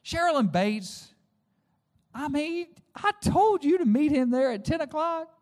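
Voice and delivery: steaming husband chews out his wife; angry rehearsed rant. Retold or imitated. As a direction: let the fury spill out. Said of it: "I was like".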